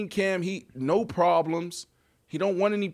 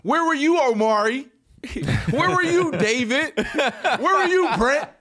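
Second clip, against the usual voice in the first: high, loud, laughing call-outs; 7 semitones higher, 5 dB louder than usual. Real, not acted. Calling out the absent teammates, loud and laughing. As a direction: call each name out like a roll call.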